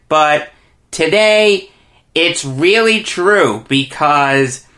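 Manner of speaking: normal talking voice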